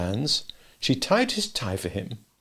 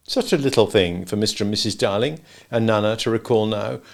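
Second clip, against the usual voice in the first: usual speaking voice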